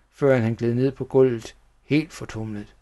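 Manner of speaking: ordinary delivery, nothing marked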